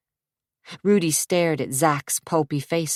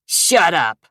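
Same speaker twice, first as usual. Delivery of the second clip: snarl; 5 dB louder than usual. Acted. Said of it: "the thug snarled".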